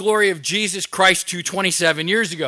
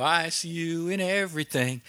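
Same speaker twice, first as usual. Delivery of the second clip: quoted song line; 3 semitones lower, 10 dB quieter than usual. Retold or imitated. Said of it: "The little song on the radio".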